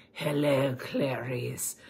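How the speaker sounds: a lightly sinister greeting; Hannibal Lecter impression. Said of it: "his hair greased back going".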